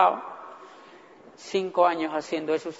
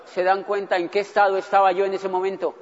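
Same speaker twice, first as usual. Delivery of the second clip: usual speaking voice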